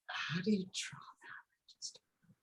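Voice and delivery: hushed, helpless wondering, fading away; baffled whisper. Real, not acted. Stuck novice musing over a drawing, baffled whisper. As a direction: drop into a whisper, as if asking yourself.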